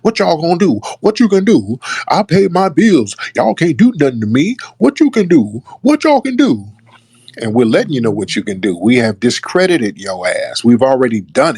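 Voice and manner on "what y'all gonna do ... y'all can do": shrill mimic of a defiant lawyer lady; mocking her rant. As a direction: parrot her defiant online rant in a higher pitch. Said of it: "she started hopping online".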